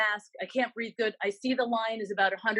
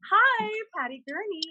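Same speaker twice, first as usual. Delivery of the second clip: much higher, chirpy businesslike hello; professional phone greeting; 11 semitones higher, 3 dB louder than usual. Retold or imitated. Realtor mocking the polished way she did not answer, much higher and chirpy. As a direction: answer like a polished agent on duty. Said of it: "I didn't answer like".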